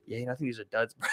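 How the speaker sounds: low, soft imitation of a friend; underwhelmed mumble. Friend answering how the pills feel, quietly underwhelmed. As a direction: quietly play the unimpressed friend.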